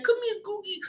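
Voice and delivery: high cooing call to a pet; cat voice. Retold or imitated. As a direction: coo it up high like calling a kitten. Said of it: "I be like".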